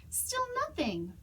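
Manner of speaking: usual speaking voice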